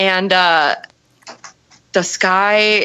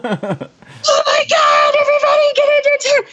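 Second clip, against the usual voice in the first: mock panic. Camper warning everybody about the storm, high mock panic. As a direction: shriek a hammed-up warning to everyone.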